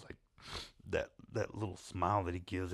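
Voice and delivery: normal talking voice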